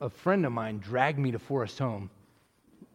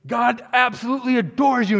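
far higher and louder, almost a scream; imitating a preacher screaming; screamed preacher climax; 11 semitones higher, 11 dB louder than usual. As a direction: throw everything at the back of the hall. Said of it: "he's screaming at everybody".

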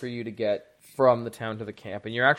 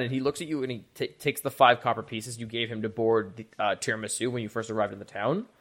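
ordinary talk

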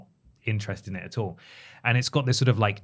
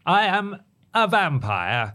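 mock AI vampire; 7 semitones higher, slower than usual. Mimicking a flat AI vampire.

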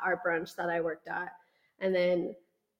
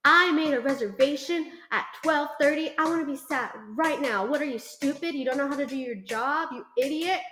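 imitating rude customer; 6 semitones higher than usual. Host imitating an old customer berating her over the reservation, in a mock-angry voice. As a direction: ham up an entitled old man berating the host.

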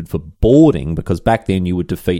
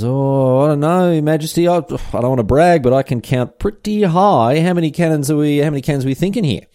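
higher, wide-eyed shipwright voice, bragging; hammed-up eager shipwright; 7 semitones higher than usual. Shipwright answering the king, higher and eager. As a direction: play Henrik keen but nervous about the number.

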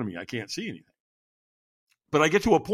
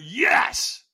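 higher, drawn-out forceful yes; stronger yes; 8 semitones higher, 3 dB louder than usual. Retold or imitated. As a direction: push the cry out harder and longer.

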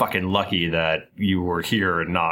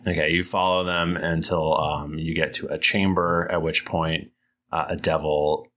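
ordinary talk, nothing marked

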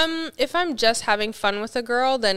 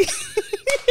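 high laugh. Real, not acted. Laughing at own impression.

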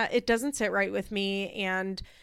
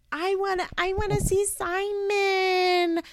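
put-on whiny child; 12 semitones higher than usual. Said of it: "Sophia's just like".

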